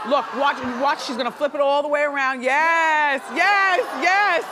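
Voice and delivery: loud, high shouting yes; excited shout. Real, not acted. Host reacting to the diamond ring clip, loud excited shouting.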